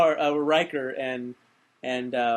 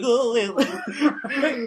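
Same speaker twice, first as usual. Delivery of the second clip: high laughing "Oh, yeah"; a goofy dazzled laugh through the words; 8 semitones higher, 3 dB louder than usual. Retold or imitated. Mocking Wesley's dazzled reply.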